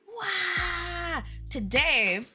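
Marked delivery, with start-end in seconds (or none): 0.1-1.2 high shouted wow; big mock gasp of awe; hammed-up wow. Exaggerated exclamation.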